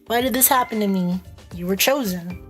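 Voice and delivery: usual speaking voice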